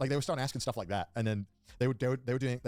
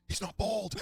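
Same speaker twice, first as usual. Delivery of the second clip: put-on high-pitched kid blurting out; mock schoolkid voice; heckling kid; 10 semitones higher, much slower than usual. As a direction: imitate a kid calling out, pitched up. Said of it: "this one kid goes".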